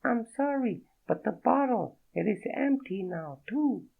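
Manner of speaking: a startled French woman, voiced high; French accent. Naked woman startled by Fredo, in a high French accent. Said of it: "The accent was real".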